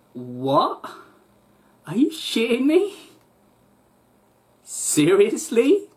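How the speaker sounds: high incredulous what?, seriously slow; high indignant disbelief at the news; outraged disbelief. Retold or imitated. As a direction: react in shocked disbelief, voice leaping up. Outraged reaction to the refusal.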